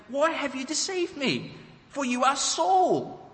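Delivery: high, startled cry of betrayal; alarmed cry. Acted. The witch crying out at Saul, high alarmed voice. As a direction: let her voice jump up in fright. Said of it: "she cried out with a loud voice".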